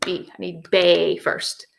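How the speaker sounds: normal talking voice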